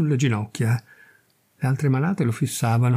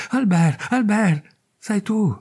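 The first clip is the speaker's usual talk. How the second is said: breathless emotion; 7 semitones higher, slower than usual. A narrator's reading. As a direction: call his name, overwhelmed. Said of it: "Lei ansimava, congestionata dalla commozione". (chiamato più acuto e forte, sopraffatta dall'emozione)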